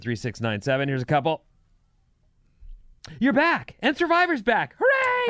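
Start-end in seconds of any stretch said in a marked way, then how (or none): 3.2-5.3 loud, high-pitched celebration; excited cheer. Acted. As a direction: cheer it, climbing to a squeal. Reads listener text with glee.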